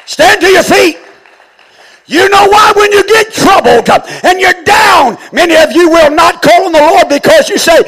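loud high preaching shout, pleading with God; preaching at full force. Acted. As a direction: cry the prayer out from the pulpit, high and loud. Preacher exhorting congregation at full force.

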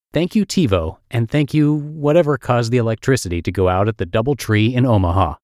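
ordinary talk

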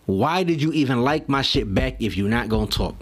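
usual speaking voice

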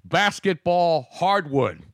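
slow, announcer-style news read; mock newscaster reading a score, slow; breaking news read. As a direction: deliver it like a bulletin, slowly. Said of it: "We have breaking news tonight".

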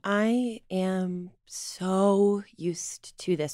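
slow, breathless whisper right under the breath. Acted. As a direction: lean in and barely voice it, slowly. Hushed aside to the other person.

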